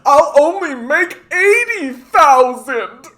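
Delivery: high, loud mock whine; hammed-up self-pitying owner; whiny rich boss. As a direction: sneer at a boss crying over profits. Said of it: "their big hardship is".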